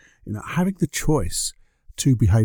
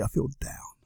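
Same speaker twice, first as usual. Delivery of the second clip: much softer unvoiced whisper; whispered gloom; 3 semitones higher, 11 dB quieter than usual. Whispering the sulky excuse about cloudy days. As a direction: let the mock complaint sink to a breath.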